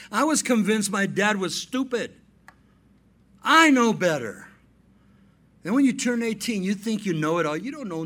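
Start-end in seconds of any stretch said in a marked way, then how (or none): 3.4-4.5 emphatic. Real, not acted. Preacher's emphatic aside.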